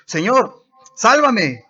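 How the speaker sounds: high, loud panicked plea; desperate cry for help. A narrator's reading. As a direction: shout it like a man sinking into the sea. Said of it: "dio voces diciendo".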